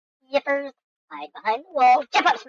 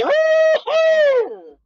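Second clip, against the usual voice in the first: gleeful whoop; 5 dB louder than usual. Real, not acted. Gamer celebrating a wacky move, with a very high loud whoop.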